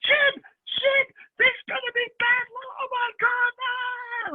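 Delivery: a hammed-up mum shrieking for help; imitating panicked mum. Mum yelling to husband Jim in hammed-up panic, very high. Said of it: "she's yelling to my dad going".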